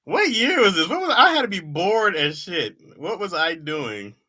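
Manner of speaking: high, loud disbelief at himself; baffled, high-pitched outburst; incredulous. Real, not acted. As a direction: pitch it up in baffled self-mockery. Laughing disbelief at own old jokes.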